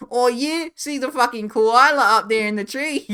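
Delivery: loud, high, hammed-up Aussie guide; mock Australian accent. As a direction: shout it like a goofy Australian pointing at a koala. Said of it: "It's just like".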